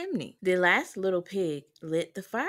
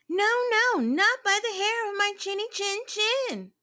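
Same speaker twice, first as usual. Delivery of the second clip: much higher little pig voice; 12 semitones higher than usual. A narrator's reading. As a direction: squeal it back as the little pig. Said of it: "and said".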